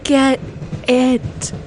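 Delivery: distressed cry. Acted. Character repeats louder.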